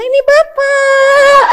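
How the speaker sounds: high, drawn-out mock cooing; sing-song baby-greeting voice, stretched out; doting new father. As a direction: ham up a parent presenting themself to a newborn. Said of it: "Bukan yang kemudian lihat".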